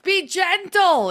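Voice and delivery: high, loud and slow mock scream. To her stretch class, mocking herself with a loud, high scream. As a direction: yell the word gentle at full force, ironically. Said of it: "And I was screaming".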